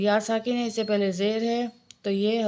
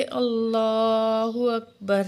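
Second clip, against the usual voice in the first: drawn-out, louder Allahu Akbar; takbir call; 4 dB louder, much slower than usual. Shown as a demonstration. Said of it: "آگے تکبیر پڑھیں گے".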